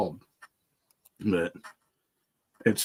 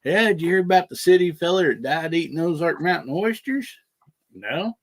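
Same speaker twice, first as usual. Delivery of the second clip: put-on old timers' joke; 7 semitones higher than usual. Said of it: "old timers would always tell".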